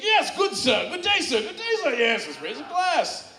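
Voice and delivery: put-on high, pompous old-fashioned gentleman voice; hammed-up gentleman. Friend accepts the drink invitation, pompous gentleman voice. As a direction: play a theatrical gent raising a toast. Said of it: "And he's like".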